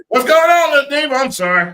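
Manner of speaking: shouted high greeting; loud excited greeting. Real, not acted. Host shouting a greeting to his cohost. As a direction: call out big and high to a friend.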